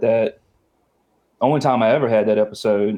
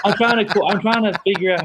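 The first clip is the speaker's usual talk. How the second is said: high, laughing no's; laughing protest; 10 semitones higher, slower than usual. Real, not acted.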